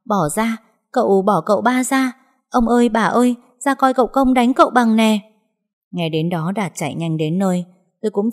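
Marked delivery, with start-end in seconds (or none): 0.1-5.2 put-on higher and louder, a servant girl yelling for help. A narrator's reading. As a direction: call out to the old master, alarmed. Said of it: "con Tí la oang oang".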